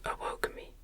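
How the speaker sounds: plain reading voice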